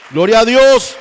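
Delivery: loud high cry of praise; praise shout. Real, not acted. Preacher to congregation, exultant loud shout. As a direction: shout glory to God.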